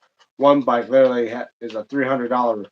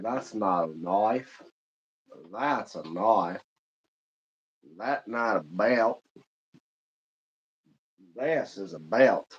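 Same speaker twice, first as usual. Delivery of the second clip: slow, softer mock-Aussie drawl; bad Australian accent; 4 semitones lower, 7 dB quieter, slower than usual. Shown as a demonstration. Streamer goofing with a film-style knife line, bad Australian accent. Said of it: "I'm not good with Australian, uh, accents".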